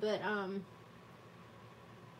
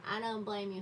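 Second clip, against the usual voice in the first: ordinary delivery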